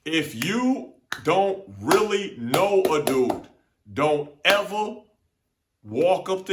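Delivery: slow loud sermon-like warning; slow emphatic moral. Real, not acted. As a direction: preach the lesson word by word. Delivering the moral.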